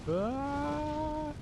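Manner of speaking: sudden high panicked yelp. Real, not acted. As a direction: yelp in alarm. Player chased and hit in game.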